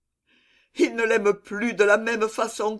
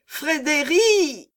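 higher, slow drawn-out call; long calling cry; 5 semitones higher, 3 dB louder, much slower than usual. Acted. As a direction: stretch the name out to be heard far. Calling out across the marsh.

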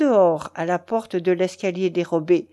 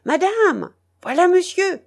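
much higher, louder warning shout; alarmed cry; 9 semitones higher, 5 dB louder than usual. A narrator's reading. Nurse running ahead to warn the marquise, crying out. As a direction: cry out to warn her mistress. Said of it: "en criant".

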